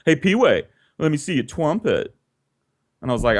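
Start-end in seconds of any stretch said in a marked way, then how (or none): 0.0-2.1 a kid's lisp, lightly mimicked; asking for the trumpet with a mild imitated lisp. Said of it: "that's how he sounded".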